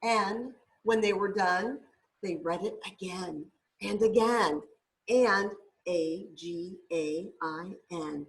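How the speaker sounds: gentle, lilting picture-book reading; storybook read-aloud voice; reading to children. Acted. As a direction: read it like a bedtime story for a little one.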